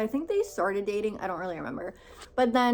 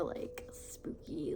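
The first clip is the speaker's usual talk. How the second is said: spooky whisper; 5 semitones lower, 14 dB quieter, much slower than usual. Pretend. Saying the word spooky.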